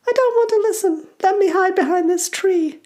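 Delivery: very high, scared little goblin; small voice. Acted. Goblin child wanting to hide from the story, small voice. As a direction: plead in a tiny high voice. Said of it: "says a small voice".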